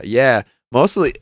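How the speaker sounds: usual speaking voice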